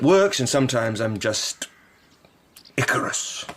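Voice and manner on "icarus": whispered aside. Acted. Curate murmuring his own failing.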